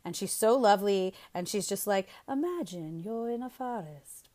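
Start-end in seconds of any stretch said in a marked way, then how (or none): 2.3-4.3 soft, badly faked British storyteller; horrible British accent. British woman telling kids a sleep story, poor British accent. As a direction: imitate a British meditation narrator, hushed. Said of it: "excuse my horrible accent".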